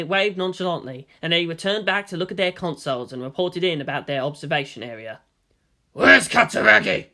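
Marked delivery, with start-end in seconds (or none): 5.9-7.1 louder gruff demand; growled. A narrator's reading. Delta One demands the officer, growling. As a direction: growl the question at him. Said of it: "Delta One growled".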